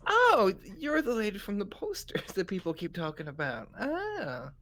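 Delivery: dawning recognition. Acted. Nurse recognizing the lady from the posters, dawning recognition. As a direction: land on a high "ah" as it clicks.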